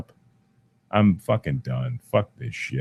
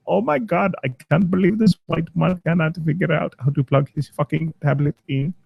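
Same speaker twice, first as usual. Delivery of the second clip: lightly put-on Indian accent, higher; a hint of an exasperated Indian call-centre agent; mock Indian accent; 6 semitones higher than usual. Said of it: "he's in India somewhere going".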